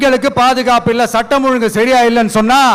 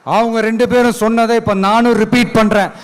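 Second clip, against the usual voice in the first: normal talking voice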